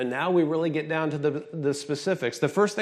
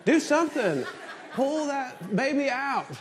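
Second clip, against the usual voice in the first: high, hammed-up panicked yell; mock yell; 9 semitones higher than usual. Dad in delivery room yelling at doctors, high mock panic. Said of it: "I'm yelling at the doctors".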